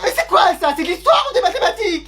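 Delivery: very high, incredulous telling-off; exasperated scolding. Acted. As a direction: shriek the question in mock exasperation. Mère outrée par la réponse. (gronder très aigu, exaspéré)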